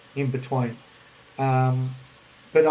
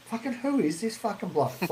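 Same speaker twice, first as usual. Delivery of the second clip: higher, incredulous inner voice; higher mock outrage; annoyed disbelief; 7 semitones higher, 4 dB quieter than usual. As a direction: scoff at the stranger.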